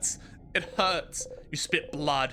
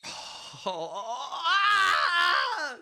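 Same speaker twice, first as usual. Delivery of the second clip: anguished cry; 10 semitones higher than usual. Real, not acted. Anguished outburst at the game.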